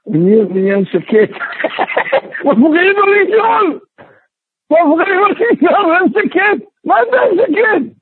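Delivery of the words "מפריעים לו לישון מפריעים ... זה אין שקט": high, mock-outraged protest; acted indignant complaint. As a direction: complain as if woken from sleep. Acted complaint about noise during sleep.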